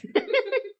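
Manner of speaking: high burst of laughter. Real, not acted. Laughs after quote.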